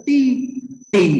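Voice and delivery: "ti, ti" with the first syllable held level, the second falling; first and fourth tones. Shown as a demonstration. Teacher reading the word aloud to show its tones, first and fourth tone. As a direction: show the two different tones in "ti, ti". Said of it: "ada nada pertama dan keempat".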